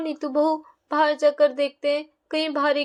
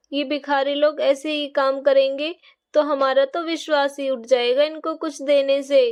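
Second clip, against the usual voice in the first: normal talking voice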